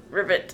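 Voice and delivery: croaky ribbit; frog croak. Pretend. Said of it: "Make that sound".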